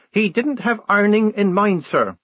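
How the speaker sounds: high, insistent protest; fervent. A narrator's reading. Girl soldier insists to her lieutenant, fervent. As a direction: press the point with fervour. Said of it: "said Polly fervently".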